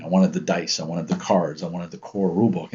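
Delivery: ordinary delivery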